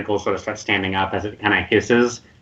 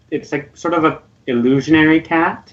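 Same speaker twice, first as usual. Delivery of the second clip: normal talking voice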